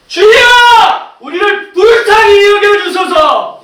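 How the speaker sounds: very high, drawn-out full shout for mercy; shouting even harder. Acted. Blind man refuses to be silenced, shouting harder. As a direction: belt out the plea, stretching every word. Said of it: "더 소리를 질러요".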